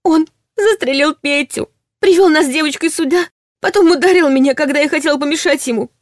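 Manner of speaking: high, tearful sobbing speech. A narrator's reading. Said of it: "не сдерживая уже рыданий".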